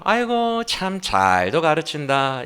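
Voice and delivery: slow, drawn-out mock admiration; sarcastic. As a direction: draw it out with dry sarcasm. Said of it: "사실상 비꼬는 거예요". (느리게 비꼬는 말투)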